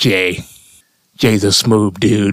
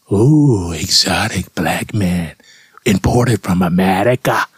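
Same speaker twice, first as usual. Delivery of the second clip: mock fetishizing admirer; slower than usual. The comic mocks German women's fetish in a slow, lowered purr. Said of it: "I think it was more of a fetish".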